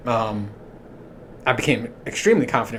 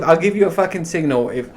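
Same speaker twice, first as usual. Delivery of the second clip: ordinary talk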